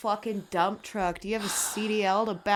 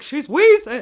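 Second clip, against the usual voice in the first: loud teasing call; 6 semitones higher, 13 dB louder than usual. Retold or imitated. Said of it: "I'm like".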